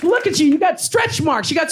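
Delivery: high, sneering taunt; mocking heckler. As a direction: jeer up high. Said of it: "he was like".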